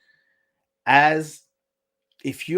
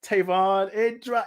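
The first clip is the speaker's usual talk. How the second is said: usual speaking voice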